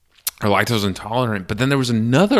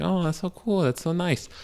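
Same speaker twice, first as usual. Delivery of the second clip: much higher, gushing fake voice; fake niceness; 10 semitones higher than usual. Fake person you meet, insincerely gushing in high pitch. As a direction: coo with insincere approval. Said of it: "the fake people that you meet".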